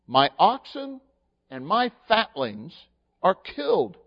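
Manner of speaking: slow, loud, declaiming King James verse; preacher declaiming scripture slowly and loudly; scripture reading. Acted. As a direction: proclaim the verse like a herald.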